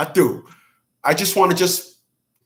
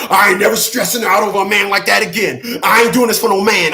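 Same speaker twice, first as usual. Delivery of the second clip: mock venting woman; 5 semitones higher, 5 dB louder than usual.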